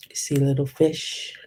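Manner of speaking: usual speaking voice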